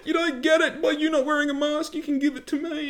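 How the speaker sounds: high, mock-whiny parody of a mask-wearer. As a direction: sneer it out in a silly high voice. Mocking a mask-wearer's argument.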